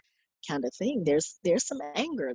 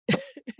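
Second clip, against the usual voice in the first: short high laugh. Real, not acted. Friend sobered up by news.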